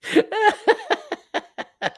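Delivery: high wheezy giggle; helpless high-pitched laughing. Real, not acted. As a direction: crack up at your own joke. Laughs at his own bit.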